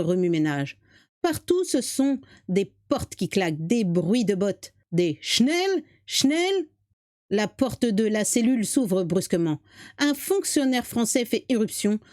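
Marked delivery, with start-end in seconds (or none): 5.2-6.7 mots allemands lancés d'une voix nettement plus haute; ordres de soldat allemand. Acted. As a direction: aboie comme un soldat qui presse des prisonniers. Soldats allemands pressant les prisonniers. (ordre allemand pressant, voix haute)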